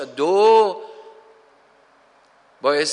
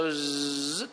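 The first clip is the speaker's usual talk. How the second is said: buzzing fly; 3 semitones lower, 8 dB quieter, much slower than usual. Retold or imitated.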